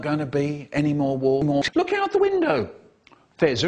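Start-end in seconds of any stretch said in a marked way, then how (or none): 1.7-2.7 very high-pitched call. Acted. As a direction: call out urgently, pitched right up. Acted urgent call.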